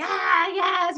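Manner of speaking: high-pitched mock team cheer; retold celebrating. Teammates celebrating a hit rock, imitated high cheer. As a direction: cheer it high, without really shouting. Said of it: "like, all celebrating".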